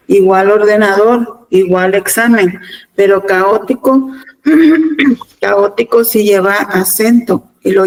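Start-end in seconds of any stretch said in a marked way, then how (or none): none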